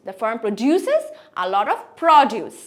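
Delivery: slow, proDUces, then PROduce; contrasting stress. Shown as a demonstration. Teacher reads the example, proDUces versus PROduce. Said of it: "প্রটার উপরে আরো বেশি জোর".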